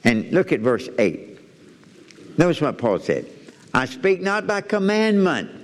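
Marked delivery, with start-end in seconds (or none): 3.7-5.5 high, slow, solemn reading; scripture reading. Acted. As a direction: read it like holy writ, slowly.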